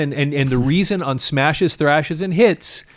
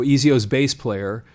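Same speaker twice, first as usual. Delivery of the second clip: ordinary delivery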